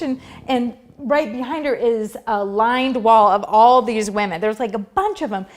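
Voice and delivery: ordinary delivery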